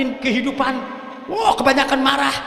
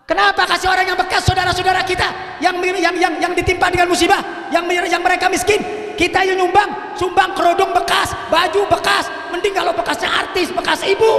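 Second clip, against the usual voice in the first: fiery preaching; 5 semitones higher than usual. Real, not acted. Preacher scolding women for giving away used clothes. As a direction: raise the voice and scold the congregation.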